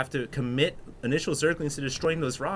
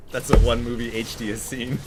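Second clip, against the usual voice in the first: mock chat voice; 4 semitones lower, 4 dB louder than usual.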